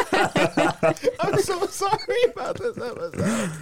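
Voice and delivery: high, slow, through laughter; laughing. Real, not acted. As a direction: apologize while cracking up. Laughing apology.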